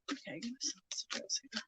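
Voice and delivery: very soft, barely voiced murmur; hushed self-talk. Real, not acted. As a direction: murmur it to yourself. Muttering to herself over cards.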